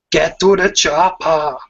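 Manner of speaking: gruff Arnold-style action hero voice; Arnold Schwarzenegger impression. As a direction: bark it like a movie commando. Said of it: "I'll try and do one".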